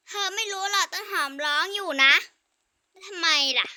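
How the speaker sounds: ordinary talk, nothing marked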